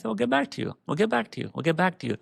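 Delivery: sing-song fake cheer, repeated; mock polite brush-off. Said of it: "they're all smiling at the end".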